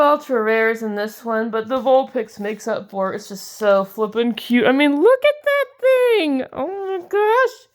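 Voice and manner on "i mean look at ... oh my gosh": much higher, gushing squeal; real excited squeal. Real, not acted. Showing viewers the Vulpix card again, squealing excitedly. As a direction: squeal it out, overwhelmed by cuteness. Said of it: "I'm getting all freaked out again".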